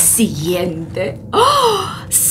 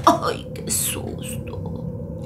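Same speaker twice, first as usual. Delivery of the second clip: slow, breathy whispered relief; scared whisper; 8 dB quieter, slower than usual. Acted. Narrator after the grandma's scare, in a slow whisper. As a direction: whisper it like you just escaped.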